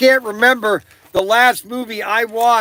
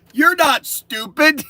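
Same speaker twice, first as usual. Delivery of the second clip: slow, high mock-earnest reply; deadpan husband; 7 semitones higher, slower than usual. Husband's deadpan reply, retold joke.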